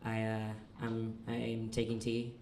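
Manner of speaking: normal talking voice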